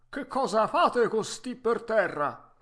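vecchietto arzillo, voce forte e più alta; Geppetto arzillo. Acted. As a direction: saluta forte, da vecchietto pimpante. (vecchietto arzillo a voce alta)

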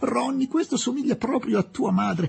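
high-pitched, animated exclaiming; excited exclamation. A narrator's reading. Young woman exclaiming to Ronnie about his mother, high and excited. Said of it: "esclamò Miss Quested".

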